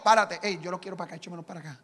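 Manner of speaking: lightly voiced boss calling him over; offhand mock command; imitating the boss. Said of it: "cuando el cocorote habló y dijo".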